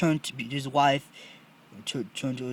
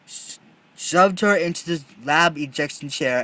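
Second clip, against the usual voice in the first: ordinary delivery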